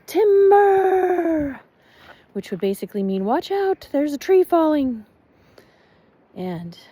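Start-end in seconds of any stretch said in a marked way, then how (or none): 0.1-1.6 high, loud drawn-out warning call; lumberjack yell. Retold or imitated. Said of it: "lumberjacks would yell". 3.2-5.0 warning shout. Retold or imitated. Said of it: "lumberjacks would yell".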